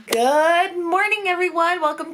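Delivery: bright, loud, high good morning; loud sunny hello to the camera; cheery greeting. Real, not acted. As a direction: greet the viewers with a big lift.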